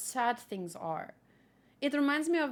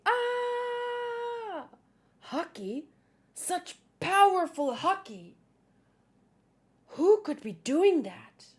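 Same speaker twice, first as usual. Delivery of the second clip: mock screaming crowd; 7 semitones higher, slower than usual. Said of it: "everyone's screaming".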